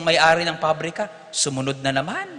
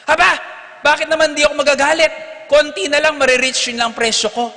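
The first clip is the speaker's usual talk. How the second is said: high, loud, fast mock fury; mock outrage; 10 semitones higher, 7 dB louder than usual. Priest revealing his real price, mock outraged shout. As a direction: bellow the punchline in mock anger.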